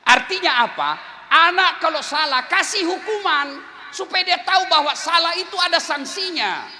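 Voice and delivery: loud, high preaching at full force; full-voiced sermon shout; fiery preaching. Real, not acted. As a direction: drive the warning home to the parents.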